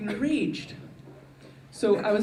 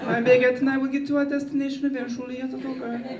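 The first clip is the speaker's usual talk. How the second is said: mock French charm, raised pitch; French accent; 4 semitones higher than usual. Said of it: "maybe a little bit more French".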